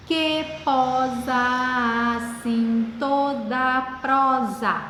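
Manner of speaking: very slow, syllable by syllable on a level pitch; escansão silabada. Shown as a demonstration. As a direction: stretch each poetic syllable, running joined vowels together. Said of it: "Vamos ver como é que vai ficar". (sílaba por sílaba, bem devagar)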